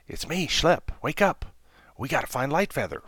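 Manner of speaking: urgent small bear waking his friend; little bear Schlepp. Acted.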